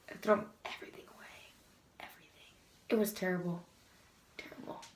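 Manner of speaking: hushed, ashamed near-whisper. Real, not acted. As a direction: drop to a whisper in embarrassment. Shameful childhood memory, voice drops.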